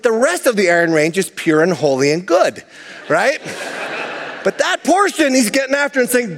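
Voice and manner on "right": usual speaking voice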